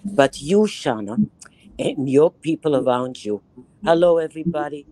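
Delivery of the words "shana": normal talking voice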